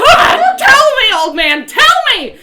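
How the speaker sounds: high, full-volume pleading demand; boiling-over frantic yell; exasperated scream. Acted. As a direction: scream at him to spit it out. Coming to a boil at innkeep.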